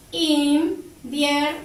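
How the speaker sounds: "Invierno" slowly as IN, VIER, NO; split into syllables. Shown as a demonstration. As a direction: separate each syllable of "Invierno" with clear gaps. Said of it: "Se carga la voz aquí". (invierno separado en sílabas, lento)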